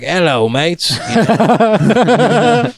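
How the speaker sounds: jokey put-on English-Australian greeting; mock English accent. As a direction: imitate your brothers' fake English accent. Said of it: "talking in English or Australian".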